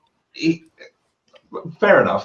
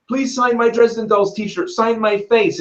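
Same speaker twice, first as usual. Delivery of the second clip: higher, pleading fan voice; eager fans; 8 semitones higher than usual. Retold or imitated. Fans mobbing the author, lightly voiced eager pleading. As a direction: beg like a breathless fan. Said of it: "it was things like".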